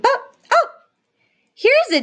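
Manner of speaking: high, hammed-up oh of discovery; mock surprise. Teacher acts out finding a digraph, playful mock surprise. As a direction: pretend you just spotted something.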